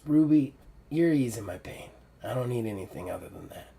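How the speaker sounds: put-on soft, fading old woman's voice; dying mentor, tender. Dying mentor thanks Ruby, soft and tender. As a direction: speak gently through the weakness.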